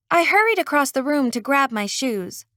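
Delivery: ordinary delivery, nothing marked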